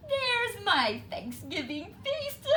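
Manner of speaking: high, laughing gloat; chuckling. A narrator's reading. Fox spotting Turkey Lurkey, chuckling high. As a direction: laugh through the words with greed. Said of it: "he chuckled".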